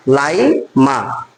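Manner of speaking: slow stress. Shown as a demonstration.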